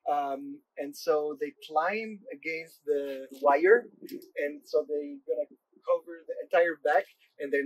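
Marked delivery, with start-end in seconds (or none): none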